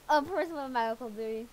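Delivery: ordinary talk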